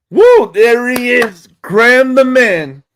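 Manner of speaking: high loud woo into a loud greeting; excited cheer. Real, not acted. Welcoming viewer Graham the Man, loud excited cheer. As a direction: cheer a friend's arrival.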